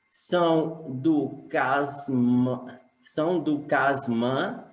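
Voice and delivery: slow verse reading, syllables drawn apart; syllable-by-syllable scansion. Shown as a demonstration. Teacher shows the class poetic syllables, slow measured scansion. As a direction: read each poetic syllable slowly and separately. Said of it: "eu separei as sílabas poéticas".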